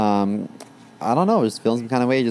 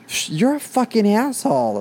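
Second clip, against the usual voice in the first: imitating angry boyfriend; 12 semitones higher than usual. Boyfriend angry at being abandoned.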